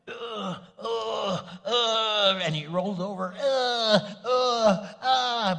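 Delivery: loud, high groans of a stiff man getting into bed; groaning old man. Retold or imitated. TV husband getting into bed, loud groaning. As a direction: grunt and moan rolling over. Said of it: "he was going".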